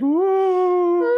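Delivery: very high drawn ooh; high squeaky voice. Shown as a demonstration. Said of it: "And the more you'd like".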